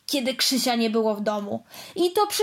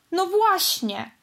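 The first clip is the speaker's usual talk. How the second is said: slow, higher aggrieved whine; complaining; 7 semitones higher, slower than usual. Acted. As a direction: drag it out, feeling wronged. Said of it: "żalił się dalej Piorun". (żałośnie, powoli)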